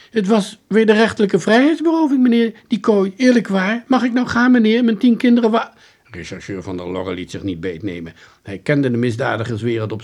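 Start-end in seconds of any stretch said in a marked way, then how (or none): 0.1-5.7 high, loud, agitated pleading; pleading house-painter suspect. Acted. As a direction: gabble innocent excuses in a high, loud voice.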